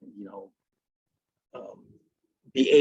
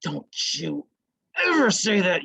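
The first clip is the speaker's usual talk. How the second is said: higher, sharp mock scolding with ever hit hard; furious parent; 5 semitones higher than usual. Parent told off by a swearing teen, mock furious scold. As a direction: snap it at the kid who just swore. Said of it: "you'd be like".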